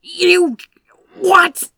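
high, loud, breathless gasp of disbelief; stunned disbelief. A narrator's reading. Aziraphale to the stranger, stunned disbelief. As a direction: caught completely off guard. Stunned when the man yanks brake.

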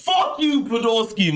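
high-pitched comic cursing; mock outburst. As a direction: curse the absent guard in a high, exasperated voice. Mock outburst at the guard nicknamed Podolski.

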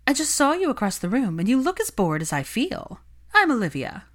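bright and chatty, sizing up a target; gossipy society witch. Acted. Narrator voices Olivia.

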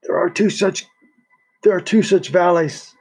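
weak and ailing, barely strong enough. Acted. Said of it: "Vitaly said in a weak voice".

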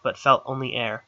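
usual speaking voice

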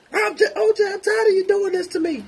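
very high, loud mock woman's voice; wife's protest. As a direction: squeal back at the husband. Wife voiced in acted quarrel.

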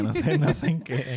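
laughing loudly through words. Real, not acted. Laughs at own hiccups.

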